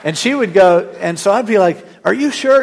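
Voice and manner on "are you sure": much higher and louder anxious check; husband nervously asking his tired wife if she's fine; nervous question. Retold or imitated. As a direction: squeak it out like a scared passenger. Nervous passenger asks driving wife.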